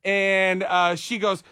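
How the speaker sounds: ordinary talk, nothing marked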